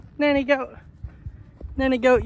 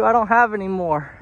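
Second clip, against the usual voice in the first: ordinary talk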